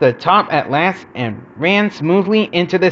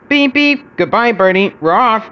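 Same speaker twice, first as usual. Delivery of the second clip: high, tooting farewell; whistling engine call; 7 semitones higher, 3 dB louder than usual. A narrator's reading. Edward leaves and bids Bertie goodbye, whistling. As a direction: toot it brightly like a train whistle. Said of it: "whistled Edward".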